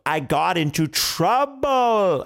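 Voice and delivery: "trouble" stretched very long; slow, over-enunciated "trouble"; exasperated repeat. Acted. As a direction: drag out "trouble" so it finally sinks in. King's last, slowest repeat.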